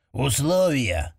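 put-on slow, rasping old goblin; weary goblin judge asking terms; hoarse old goblin. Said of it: "хрипло и уже привычно спросил старый гоблин".

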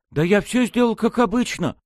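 high, defensive protest; nervous retort. A narrator's reading. Fish-masked man defending his work to accomplice, nervous and snappish. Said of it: "огрызнулся рыба нервно".

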